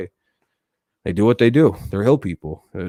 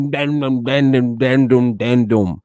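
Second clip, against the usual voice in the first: mock Appalachian mumble; 3 semitones higher, slower than usual. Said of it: "they say just, like, phrases".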